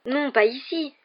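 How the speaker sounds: high-pitched, urgent protest. A narrator's reading. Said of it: "protesta Harry".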